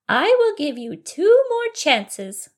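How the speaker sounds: high, slow fairy warning. Acted. Fairy giving bunny two chances, slow high fairy voice. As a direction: lay down the terms slowly, high and loud. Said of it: "she said in a fairy-like voice".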